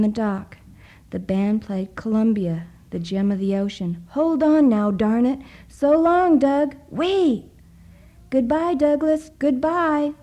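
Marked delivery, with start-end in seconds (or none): 4.1-7.4 high, loud calls across the water; shouted farewell. A narrator's reading. People on the dock shout goodbye to Doug, loudly. 8.3-10.1 high, loud farewell calls; crying out. A narrator's reading. Librarians call goodbye to Doug, loud and high. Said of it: "cried the two town librarians".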